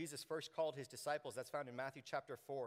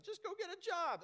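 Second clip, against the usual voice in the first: squeaky know-it-all telling you to get a job; mock a glib adviser, high; high mocking advice voice; 5 dB louder than usual. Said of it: "Somebody says".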